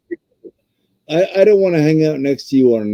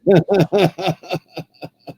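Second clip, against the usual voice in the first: higher, rolling laughter; laughing; 4 semitones higher, 3 dB quieter than usual. Real, not acted. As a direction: laugh at your own dim act. Laughs after playing dim.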